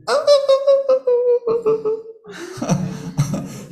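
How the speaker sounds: high squealing mock monkey shriek with laughter. Teacher asked for monkey sounds, playful high shriek. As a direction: shriek like a panicked monkey for the class. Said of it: "How does the, uh, monkey who's freaking out sound like?".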